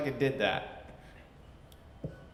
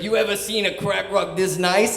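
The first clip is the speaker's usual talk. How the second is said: imitated New York boss, pitched up; higher, boastful New York voice; New York accent; 6 semitones higher, 3 dB louder than usual. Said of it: "he talked like he had a New York accent stuck in his throat".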